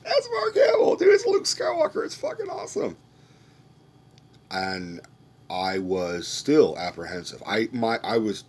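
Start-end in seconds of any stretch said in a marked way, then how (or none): 0.0-3.0 very high, loud fanboy squeal. Retold or imitated. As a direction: shriek it like an overexcited fan. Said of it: "You're like".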